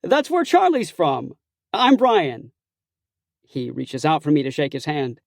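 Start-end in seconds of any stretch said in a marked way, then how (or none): 0.0-2.5 excited. Acted. Excited stranger keeps exclaiming.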